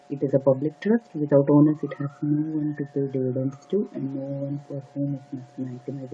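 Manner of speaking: exam read-aloud demo. Shown as a demonstration. Reading the sample paragraph for test-takers, quick and steady. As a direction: rattle through the passage at exam pace. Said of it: "So in forty seconds, I'm gonna just read through it".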